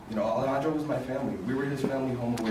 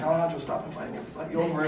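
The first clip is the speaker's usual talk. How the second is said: laughing scold; 6 semitones higher, faster than usual. Retold or imitated. Scolding roommate for guests.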